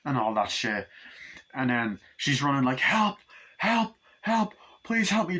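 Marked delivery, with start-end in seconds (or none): 2.8-5.4 high-pitched acted cries for help; mock-panicked plea; desperate pleading. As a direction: play a fleeing victim flagging someone down. Acts out fleeing woman begging.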